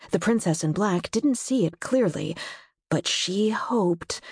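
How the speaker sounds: ordinary delivery, nothing marked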